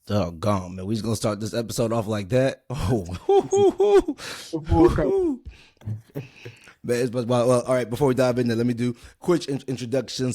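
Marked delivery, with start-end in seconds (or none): 3.1-5.5 high, loud burst of laughter; a real, loud laugh in disbelief at the story; big laugh. Real, not acted. Reacting to shocking story.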